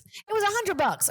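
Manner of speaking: high-pitched indignant aside; comic, high-voiced self-justification; defensive protest. Real, not acted. Defensive aside about the price.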